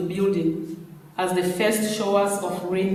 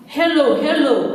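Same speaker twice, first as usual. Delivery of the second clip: higher, louder phone greeting; voicing Pamela on phone; 6 semitones higher, 5 dB louder than usual. A narrator's reading. Pamela picks up Jerry's call, bright and higher.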